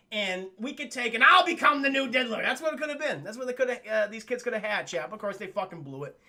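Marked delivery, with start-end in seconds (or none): none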